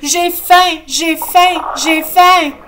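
high, loud repeated wail; tantrum cry. Acted. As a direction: roll on the ground wailing. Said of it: "se roule en criant". (caprice crié, aigu et fort)

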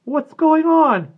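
very high, loud, drawn-out cry; cracking adolescent voice. A narrator's reading. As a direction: wail it in a cracking boy's voice. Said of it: "Sandy's changing voice cracked and snorted".

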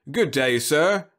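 slow, high, loud cheery robot salesman. Acted. Robot vendor greeting a scorched customer, slow bright pitch. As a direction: greet him like a tireless vending robot.

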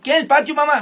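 put-on high, loud startled kid; whiny child. Comedian as a child protests being taken outside.

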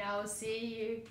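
usual speaking voice

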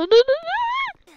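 very high rising "no"; panicked squeal. Real, not acted. Player as infected approach, panicked squeal. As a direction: squeal as the danger closes in.

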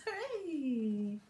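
plain reading voice

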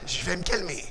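very soft, settling voice; calming down. Shown as a demonstration. Child-class routine for handling anger, soft calming voice. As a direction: bring yourself down gently.

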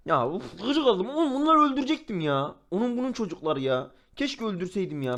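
high-pitched exasperated outburst; huff, then jump up in pitch with frustration; irritated. Real, not acted. Gamer regretting he spared his opponents, exasperated.

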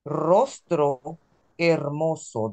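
hermoso said as ermoso, slow; silent h. Shown as a demonstration. Teacher to class: read slowly with a silent H. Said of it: "The H is silent".

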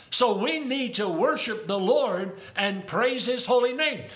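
ordinary talk

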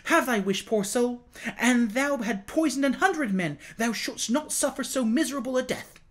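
high-pitched, impassioned outburst; crying out. A narrator's reading. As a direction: cry it out in shocked compassion. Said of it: "he cried out".

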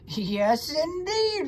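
lightly voiced rat, rising high; obliging rat. A narrator's reading. Rat agrees to lend the pig string, obliging. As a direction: answer as an eager rat.

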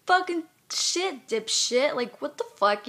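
high, slow, angry insult; angry retort. Real, not acted. Woman answering her photo's critic, angry.

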